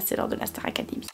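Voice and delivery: fast breathy whisper, voice nearly gone; hushed whisper. Real, not acted. Vlogger with a sore throat whispers to her viewers.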